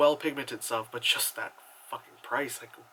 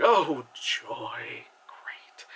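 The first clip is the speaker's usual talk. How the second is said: hushed, deadpan mock delight; sarcastic; 3 semitones lower, much slower than usual. Real, not acted. Reviewer reacts to the film's slavery opening, sarcastic. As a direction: fake the joy in a dry whisper.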